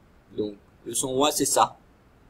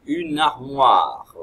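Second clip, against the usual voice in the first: "une armoire" said slow and louder; slow clear word; 3 semitones higher, 12 dB louder, much slower than usual. Shown as a demonstration. Teacher naming the picture for children, slow and loud. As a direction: stretch out "une armoire" loudly. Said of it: "Quelle est la syllabe".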